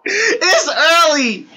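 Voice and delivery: loud, high, slow shouting; yelling. Real, not acted. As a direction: shout it into the mic. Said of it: "why I'm yelling in general".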